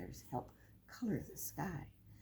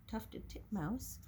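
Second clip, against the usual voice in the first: ordinary delivery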